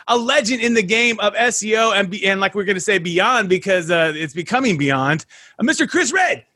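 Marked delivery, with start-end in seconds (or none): 5.6-6.4 high-pitched hype-man introduction; excited, soaring host greeting; fired up. Real, not acted. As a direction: bring the guest on with a big high shout-out. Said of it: "I need to get up to your level".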